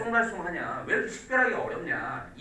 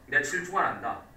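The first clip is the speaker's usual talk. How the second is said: ordinary talk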